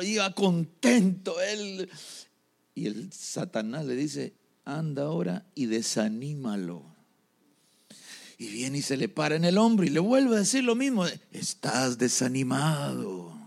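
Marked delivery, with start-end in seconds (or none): 4.6-6.8 lightly voiced Satan, lower and softer; a low, quiet command to a minion; a hint of a sly devil boss; Satan giving orders. Satan orders a demon in story. 11.3-13.4 low, slow demon suggestion; slow, lowered voice of a tempter; tempting demon. Acted.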